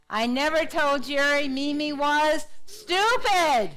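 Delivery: stupid stretched high and loud at the end; slow build to a big, loud stupid; stress on stupid. Shown as a demonstration. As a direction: save all the punch for stupid. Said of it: "putting the emphasis on a different word".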